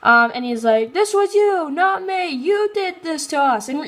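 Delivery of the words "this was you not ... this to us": high, strained accusing cry; anguished accusation. Retold or imitated. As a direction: blame him, voice pitched up high.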